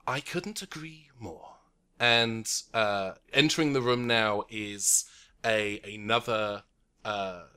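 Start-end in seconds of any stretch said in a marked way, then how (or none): 0.0-1.5 unvoiced soft whisper from the doorway; a woman whispering from behind the door. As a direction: breathe it like a secret. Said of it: "a woman's voice".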